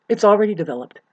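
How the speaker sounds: normal talking voice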